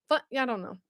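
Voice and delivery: normal talking voice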